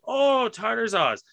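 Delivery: the penny finally drops; sudden realisation. Retold or imitated. Waiter realising his sauce mistake, high and slow. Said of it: "And the guy's like".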